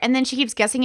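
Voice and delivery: ordinary talk, nothing marked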